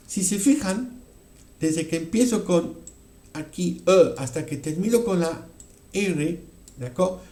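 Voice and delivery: normal talking voice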